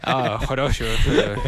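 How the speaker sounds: a light parody of Afrikaans sounds, babbled; mock Afrikaans gibberish. Friend mocks how foreigners imitate Afrikaans, light gibberish. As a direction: babble fake Afrikaans syllables, half laughing. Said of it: "mimic Afrikaans".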